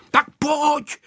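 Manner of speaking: high, urging call; shouting boy. A narrator's reading. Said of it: "křičel Vojta". (naléhavé klukovské volání)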